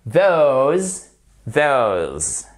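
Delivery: "those" drawn out, voiced th at the start; model pronunciation. Shown as a demonstration. Teacher modelling "those" for learners, long and careful. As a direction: stretch "those" slowly with a voiced th.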